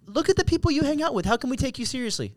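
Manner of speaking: high, fast mock indignation; rapid, high-pitched accusation; imitated accusers. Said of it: "They said".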